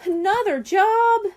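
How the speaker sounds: high, loud and slow dismayed wail; wailing complaint. A narrator's reading. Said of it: "Brian wailed".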